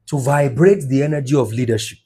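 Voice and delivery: ordinary talk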